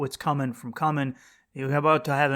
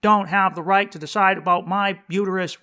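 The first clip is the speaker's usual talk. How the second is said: mocking protester; 4 semitones higher, 5 dB louder than usual. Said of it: "for everyone who's like".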